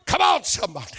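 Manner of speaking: ordinary delivery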